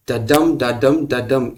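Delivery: डडम chanted with the second beat stressed; da-DUM rhythm. Shown as a demonstration. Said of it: "ये अगर follow किया जाए तो उसे iambic कहते हैं". (डडम को कमज़ोर-ज़ोरदार ताल में बोलें)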